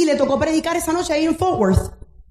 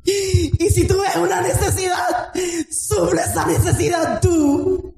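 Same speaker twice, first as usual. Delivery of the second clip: strained, raised-pitch sermon exhortation; impassioned preaching; 4 semitones higher, slower than usual. Real, not acted. Preacher urging the brethren that they are the church, impassioned. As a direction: preach it urgently, drawing out the key phrases. Preaches with full force to congregation.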